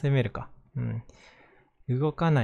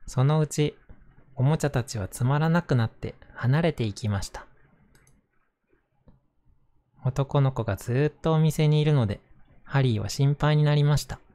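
gentle storybook narration voice; reading to children; 4 dB louder than usual. Acted. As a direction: read it aloud to little kids. Reads a picture book aloud.

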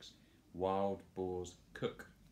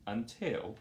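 plain reading voice

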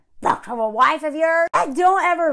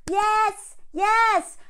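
higher, egging on like a stadium crowd; mock cheering crowd; 8 semitones higher than usual. Imitating the Jumbotron crowd urging her to say yes, cheering. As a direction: chant it like the crowd pressuring her.

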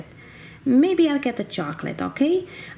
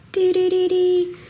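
high, loud sing-song jingle; truck jingle; 8 semitones higher, 4 dB louder than usual. Pretend. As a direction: chime it out like an ice cream truck. Imitating the ice cream truck jingle.

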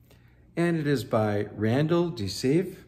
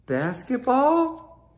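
much higher, slow, coaxing character voice; hopeful question; 13 semitones higher, 8 dB louder, much slower than usual. Acted. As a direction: drag it out hopefully in a high voice. Fifi offers the ball game.

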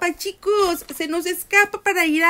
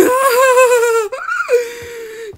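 grito de susto; 7 semitones higher, 6 dB louder than usual. Real, not acted. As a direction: scream of fright into laughter. Susto en juego de terror. (grito asustado con risa)

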